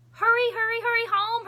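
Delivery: put-on high, loud child's call; little brother calling. Little brother calling his sister home, high and loud. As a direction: call after her like a little kid. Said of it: "her brother called".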